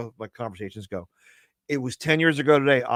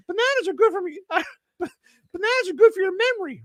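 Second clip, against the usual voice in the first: much higher cute dummy voice; squeaky puppet voice. Acted. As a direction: chirp it like a tiny cute puppet. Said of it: "he says".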